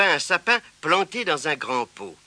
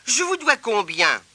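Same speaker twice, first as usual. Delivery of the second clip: put-on high, happy child's voice; eager child; 9 semitones higher than usual. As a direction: ask politely, pleased. Boy talks to shopkeeper.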